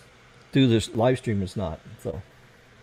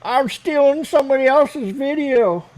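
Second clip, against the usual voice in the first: high, drawn-out mock troll voice; slow, louder taunt, pitched way up; 13 semitones higher, 5 dB louder, slower than usual. Streamer quotes his troll's accusation, mocking high drawl. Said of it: "the troll says".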